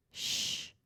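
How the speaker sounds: unvoiced shush; hushing. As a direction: hush everyone softly. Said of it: "I said".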